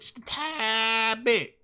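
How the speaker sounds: "just a tad bit" stretched very slowly; drawn-out repeat. Real, not acted. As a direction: drag out "tad bit" pleadingly. Stretching the phrase.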